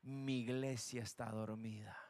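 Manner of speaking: God mourning his sleeping church, low and hushed. Acted. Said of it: "la voz de Dios que dijo".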